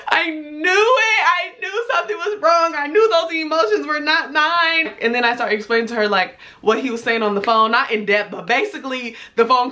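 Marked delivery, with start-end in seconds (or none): 0.1-4.9 high, tearful retold wail; mock crying. Crying to Tammy in the other room, mock wail. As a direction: whine it out as if in tears. Retells crying to her friend.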